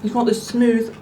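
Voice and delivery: talking through a mouthful of food; mouth full. Real, not acted. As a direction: say it while still chewing. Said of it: "sorry, talking with my mouth full".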